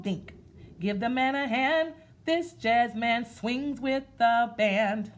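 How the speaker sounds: slow, sing-song rhythmic reading; read-aloud rhyme. Acted. Teacher reading a jazz picture book to kids, in a slow sing-song. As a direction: chant it like a nursery rhyme, slow.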